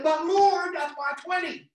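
high, loud mock whine to God; mock protest. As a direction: protest like a stingy child. Protesting to the Lord, retold.

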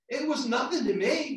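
ordinary talk

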